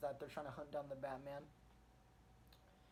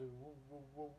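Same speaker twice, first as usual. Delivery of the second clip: imitated helicopter whoop, softer; helicopter noise; 7 dB quieter than usual. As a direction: mimic a helicopter passing overhead.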